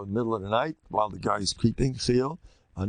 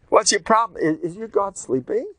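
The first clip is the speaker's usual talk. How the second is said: high, loud sarcastic jeer; mocking taunt; 8 semitones higher, 4 dB louder than usual. Eliyahu taunting the prophets of Baal, loud mockery. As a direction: needle them with fake concern.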